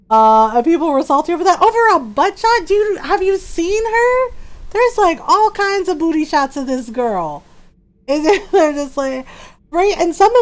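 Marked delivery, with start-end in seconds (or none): 0.1-6.7 indignant rant. Real, not acted. Indignant rant about fans.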